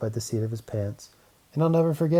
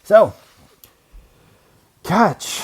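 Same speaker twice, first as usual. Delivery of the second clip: loud call; 8 semitones higher, 10 dB louder than usual. A narrator's reading. Onceler calling to the child as he throws the seed. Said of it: "calls the Onceler".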